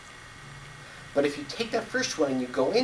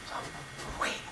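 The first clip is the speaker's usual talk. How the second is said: whisper; 15 dB quieter than usual. Retold or imitated. Boy waiting for bubbles.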